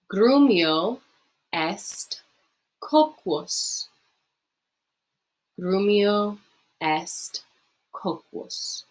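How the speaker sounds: slow, syllable by syllable, for the class to repeat; slow model reading. Shown as a demonstration. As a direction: read it out slowly so students can copy. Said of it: "practice saying it yourself".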